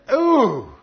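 high, loud, falling "ooh". Real, not acted. Preacher reacts to his own question to congregation, loud ooh. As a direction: exclaim a big knowing ooh.

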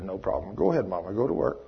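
low, soft, unworried voice; calm reassurance. Retold or imitated. Babysitter to worried Mama leaving for work, calm reassurance. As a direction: gently send her on her way.

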